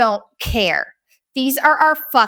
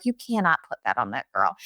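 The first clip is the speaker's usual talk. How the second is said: low firm quiet; 11 semitones lower, 7 dB quieter than usual. Retold or imitated. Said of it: "And I was like".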